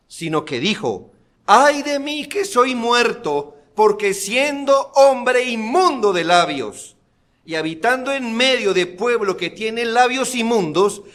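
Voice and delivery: full-force preaching. Acted. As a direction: drive the point home from the pulpit. Preaching Isaiah's cry. (predicación enérgica y fuerte)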